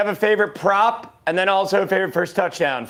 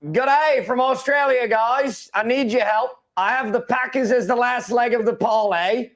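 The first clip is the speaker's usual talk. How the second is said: put-on Australian accent, higher-pitched; 4 semitones higher than usual. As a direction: read it out like a drawling Aussie caller. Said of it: "answer in the Australian accent".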